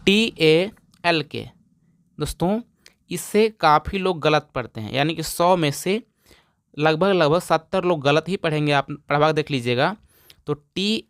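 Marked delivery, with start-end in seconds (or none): none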